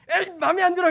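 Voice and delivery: high, loud mock pout; sulky refusal. Believer sulking at God like a spoiled child, loud whine. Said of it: "이러고".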